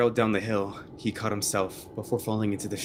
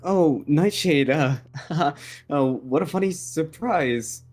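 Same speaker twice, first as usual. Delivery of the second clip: awkward feigned surprise; slower than usual. Acted. Caught out, feigns pleasant surprise.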